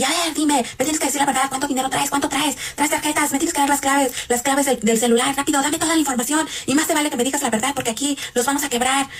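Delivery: high-pitched, pressing mimicked interrogation; retold threats. Robber demanding money and passwords from victim, retold threatening demands. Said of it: "todo el tiempo nos están amenazando".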